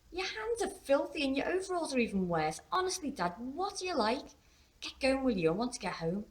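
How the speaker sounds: put-on high-pitched teenage scolding; tutting daughter. A narrator's reading. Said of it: "She tutted".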